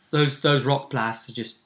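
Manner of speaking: ordinary talk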